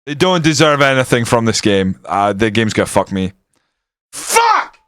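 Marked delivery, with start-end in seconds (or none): 4.1-4.8 very high sharp curse; frustrated yelp. Real, not acted. Fan as his team concedes, high furious yelp. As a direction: snap it out at the screen.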